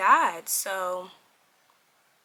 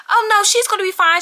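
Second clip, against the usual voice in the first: breezy vet reassurance; 11 semitones higher, 8 dB louder, faster than usual. Retold or imitated. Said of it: "and they said".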